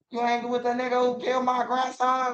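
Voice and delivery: high, soft scolding grandma; granny voice. Retold or imitated. Granny scolding her grandson over the killer, high and soft. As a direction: let the grandma voice climb up high and accusing. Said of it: "my granny will be like".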